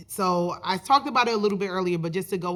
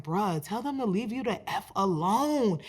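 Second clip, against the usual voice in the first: softer, laid-back take on his friend's voice; imitating his friend; 5 dB quieter than usual. Middle-school friend telling him to shake off people, imitated softly. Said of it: "He talks like this".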